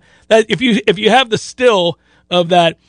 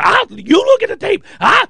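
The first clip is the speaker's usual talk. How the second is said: high shouted retort; mock shout; 10 semitones higher than usual. Coach yelling back at quarterback, mock shout. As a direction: yell it back dismissively.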